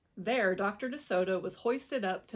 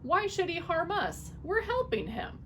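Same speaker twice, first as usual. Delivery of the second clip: much higher wife voice; wife's voice; 10 semitones higher than usual. A narrator's reading. Missus DeSoto questioning her husband, worried.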